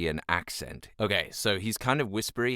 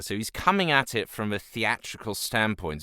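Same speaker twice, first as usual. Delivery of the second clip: usual speaking voice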